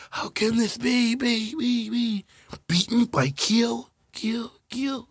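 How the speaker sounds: loud, high hammed-up anime duel. Acting out the friends' card duel, hammed up. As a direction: shout it like a cartoon duelist.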